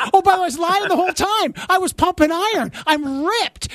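high-pitched, quick gloating brag; mock boasting. Imagining a comedian's topless reveal, mock boast. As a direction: crow it like a big reveal.

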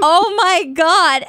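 high, loud, stretched-out exclamation; overwhelmed reaction. Retold or imitated. Girlfriend given a dry breakfast, overwhelmed anyway.

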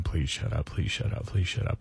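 soft, low mutter; muttered plea. Retold or imitated. Passenger wishing the chatty driver would stop, muttered plea. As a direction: plead under your breath, low and quiet. Rider's silent plea in retold ride.

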